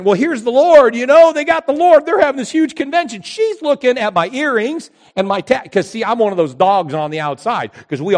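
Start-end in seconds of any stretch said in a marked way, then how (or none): 0.0-3.2 high, loud excited thinking; excited inner thought. Retold or imitated. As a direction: burst out with excitement in your head.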